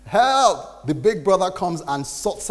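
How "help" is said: sudden high call for help; mock yell for help; mock scream for help. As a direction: cry out help, pitched up.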